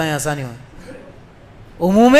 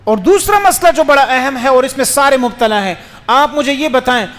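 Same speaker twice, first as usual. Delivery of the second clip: usual speaking voice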